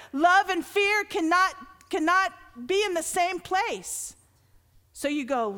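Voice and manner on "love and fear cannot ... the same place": raised, louder preaching build; preaching at full force. Acted. Pastor preaching passionately to the church, loud and lifted. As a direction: preach it out to the congregation.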